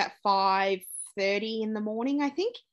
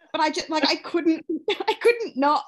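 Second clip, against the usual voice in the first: laughing; 8 semitones higher, 5 dB louder than usual. Real, not acted. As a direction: giggle it out at herself. Laughing at herself.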